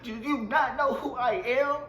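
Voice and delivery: slowed, haughty do you know who I am; mock diva outrage. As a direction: drag out the entitled question like a star. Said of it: "I was like".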